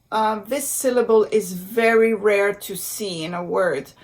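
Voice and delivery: normal talking voice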